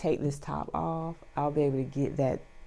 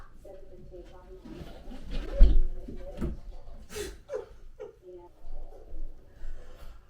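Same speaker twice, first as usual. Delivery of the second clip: breathless laugh; 3 dB louder than usual. Real, not acted. After straining with heavy piece.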